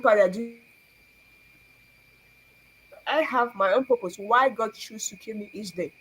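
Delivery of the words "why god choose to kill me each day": ordinary delivery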